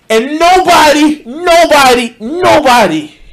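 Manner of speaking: high, loud repeated nobody; throwing up hands in disbelief; exasperated. Real, not acted. Ranting at the video, exasperated repeats. Exasperated rant about unnamed group.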